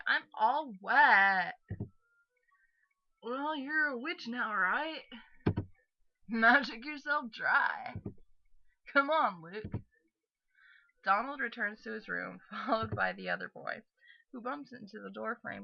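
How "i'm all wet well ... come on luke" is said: loud, higher, drawn-out complaint; exasperated wet girl. Acted. Wet girl telling someone to magic themselves dry, exasperated. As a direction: stretch the key words in loud exasperation.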